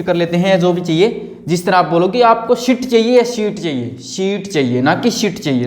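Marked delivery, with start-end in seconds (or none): none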